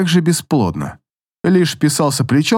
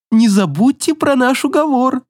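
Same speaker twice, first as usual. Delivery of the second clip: sing-song; 10 semitones higher than usual. Acted. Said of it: "уже в спину мне пропел Горевит".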